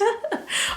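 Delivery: short high-pitched giggle. Real, not acted. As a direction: burst into a squeaky laugh. Laughs at the perfume request.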